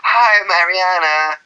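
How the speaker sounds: high, slow, sugary pop-star hello. Pretend. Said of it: "if [name] looked like this".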